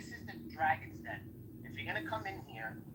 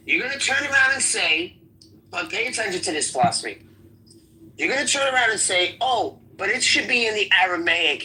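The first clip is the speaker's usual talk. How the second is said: real loud, hectoring demand; heated challenge; 17 dB louder than usual. Real, not acted. Debater challenging an opponent about a Greek word, loud and heated. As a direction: hammer the questions out at full volume.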